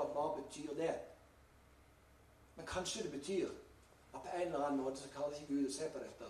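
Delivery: ordinary talk, nothing marked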